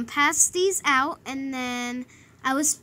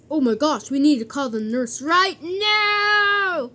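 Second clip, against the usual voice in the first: alarmed teacher; 3 dB louder than usual. Acted. Teacher sees the cut and needs the nurse, alarmed. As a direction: stretch the final words in mock urgency.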